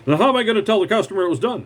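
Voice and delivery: fast, high-pitched indignant demand; mimicking a worked-up manager, rushed and high; acted berating manager. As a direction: rattle it off like an exasperated boss. Acting out manager berating him.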